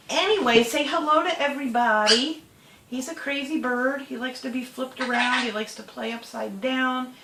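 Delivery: high, loud chirpy presenting voice; bright pet voice. Real, not acted. Owner introducing parrot to viewers, high chirpy pet voice. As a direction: show off the bird cheerfully. Talking up at bird on shoulder.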